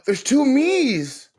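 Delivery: high, slow astonished gasp; gasping surprise. Real, not acted. Streamer sees herself twice on screen, gasping in surprise. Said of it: "seeing self on screen".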